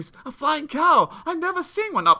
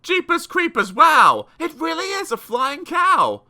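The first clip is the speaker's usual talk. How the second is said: high, loud amazed crowd; shouting; 7 semitones higher, 7 dB louder than usual. Acted. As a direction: shout in wonder. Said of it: "The shouted".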